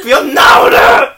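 loud slow laughing shout. Real, not acted. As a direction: yell it through laughter. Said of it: "shouting playfully".

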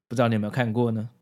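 usual speaking voice

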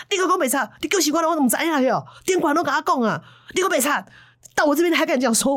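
high and fast, ranting; rapid furious scolding. Retold or imitated. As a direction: fire the accusations at him. Master rages at the liar. (师兄连珠炮怒骂)

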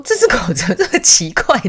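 high, loud, laughing disbelief; puzzled outburst with a laugh in it; amused bafflement. Retold or imitated. Retold bafflement at the dog.

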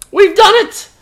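high, loud and proud; triumphant cry. Acted. Gabu tells the king the land is covered, high triumphant shout. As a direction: announce the victory. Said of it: "cried Gabu".

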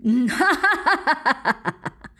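loud, high monstrous laughter; mocking giant roar of laughter; booming dragon laugh. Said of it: "巨龙大笑".

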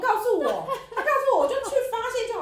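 high, loud laughing grumble; laughing complaint. Real, not acted. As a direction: laugh while pretending to sulk. Teasing friend, laughing.

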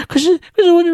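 very high, tearful pleading voice; whiny crying woman. Retold or imitated. Mocking the woman in line as she whines to the guard, high and tearful. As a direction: squeak it out like someone about to burst into tears. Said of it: "那个女的居然就开始哭欸".

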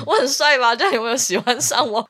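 cocky, showing-off voice; mock the boastful driver; smug guy voice. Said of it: "他就说".

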